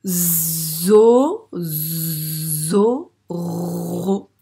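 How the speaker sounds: "Zo, zo, ro" with a long buzzed Z and rolling R; Z appuyé. Shown as a demonstration. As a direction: draw out each sound of "Zo, zo, ro", loud, rising on "Zo". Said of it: "il fait bien le son Z". (« Zo, zo, ro » en allongeant fort le Z)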